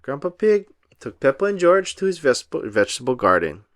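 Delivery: plain reading voice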